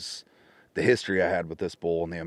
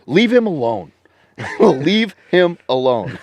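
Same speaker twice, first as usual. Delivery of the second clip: louder and higher mock yell, laughing through it; retold screaming; 8 semitones higher, 10 dB louder, slower than usual. Friend Justin yelling through phone to leave the bull, mock yell. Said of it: "I hear Justin screaming to the phone".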